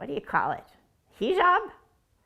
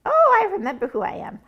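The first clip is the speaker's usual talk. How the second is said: sudden realization; 6 semitones higher, 5 dB louder, faster than usual. Acted. Character suddenly remembers identity.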